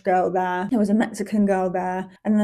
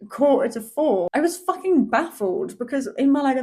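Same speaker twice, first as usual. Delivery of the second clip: normal talking voice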